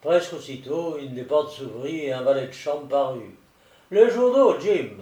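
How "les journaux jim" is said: high, loud call for the servant. Acted. Master calling his valet for the morning papers, calling out. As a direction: summon the valet in a raised, lifted voice.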